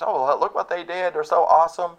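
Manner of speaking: preacher mimics proud descendants, mock gushing; mock bragging. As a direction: show off the ancestors, smug and starry-eyed. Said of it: "You brag on your fathers".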